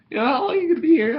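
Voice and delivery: squeaky high ask; high teasing question. Real, not acted. Friend teasing vlogger about the length, high.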